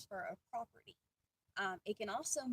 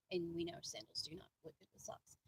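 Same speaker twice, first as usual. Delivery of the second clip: whispered joking aside; hushed wisecrack under breath; smart-aleck aside; 4 semitones lower, 6 dB quieter than usual. Real, not acted. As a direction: whisper the cheeky jab. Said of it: "I have to be a smart aleck".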